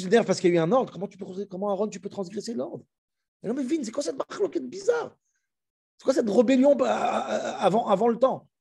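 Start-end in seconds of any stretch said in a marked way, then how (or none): 3.4-8.5 higher, baffled protest; what is this rebellion, pitched up; pitched-up disbelief at a strange dispute; incredulous outrage. Acted.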